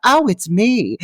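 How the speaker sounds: high, called-out mock mom voice; hammed-up high phone greeting; mom impression. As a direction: imitate a mom announcing herself on the phone. Imitating the cheerful mom answering.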